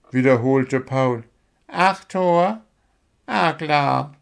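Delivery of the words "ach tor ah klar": drowsy owl, pitched high; Willi, sleepy. A narrator's reading. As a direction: answer half-asleep in a higher voice. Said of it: "murmelte Willi verschlafen".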